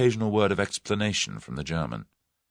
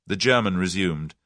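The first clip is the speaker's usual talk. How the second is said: usual speaking voice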